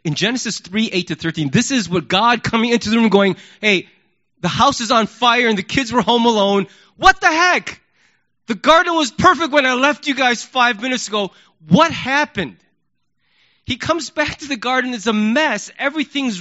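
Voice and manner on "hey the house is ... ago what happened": loud, high exasperated outburst; exasperated angry parent. Retold or imitated. God storming in on the ruined garden, exasperated. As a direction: storm in on the mess, fuming.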